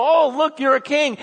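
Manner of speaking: loud, high sarcastic homage; mocking. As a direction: jeer with fake awe at the king. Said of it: "they're totally mocking him".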